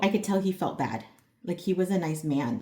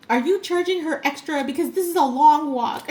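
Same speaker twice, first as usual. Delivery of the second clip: higher and louder mock complaint; imitating grumpy mover; 9 semitones higher, 8 dB louder than usual. Grumpy older mover objecting to an extra charge, mock complaint. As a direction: play the old man scolding his boss. Mover scolds the main guy.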